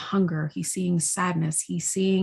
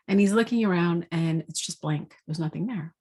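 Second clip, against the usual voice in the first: ordinary delivery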